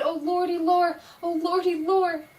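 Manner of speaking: high, loud terrified cry; frightened cry. Acted. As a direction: cry out shaking with fear. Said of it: "shivered and shook and cried".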